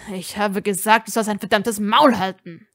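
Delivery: furious order; angry. Acted. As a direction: lean into every word. Ron furious at Draco.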